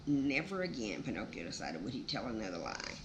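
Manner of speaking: ordinary delivery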